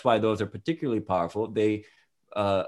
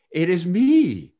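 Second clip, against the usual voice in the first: "It is me" drawn out, high and loud; emphatic realization; 8 semitones higher, 7 dB louder, slower than usual. Acted. Reader voices man recognizing his body, emphatic drawn-out "It is me". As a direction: stretch "It is me" slowly, pitched up and louder.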